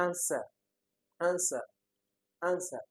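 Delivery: "answer" as AN-suh, high start then falling; first-syllable stress. Shown as a demonstration. Teacher gives the correct option, "answer" stressed on the first syllable. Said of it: "the stress is on the first syllable".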